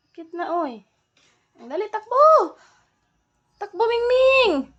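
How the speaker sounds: high, loud shout at a pet; calling out loudly. Real, not acted. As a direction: yell up to the pet to hurry.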